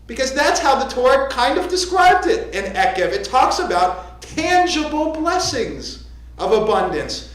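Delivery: usual speaking voice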